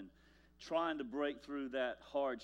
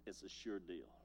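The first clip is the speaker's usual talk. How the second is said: quiet stubborn insistence; 5 semitones lower, 8 dB quieter than usual. Retold or imitated. Pastor recalling brushing off his wife, quietly stubborn. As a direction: lower and softer, brushing off a warning. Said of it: "I said".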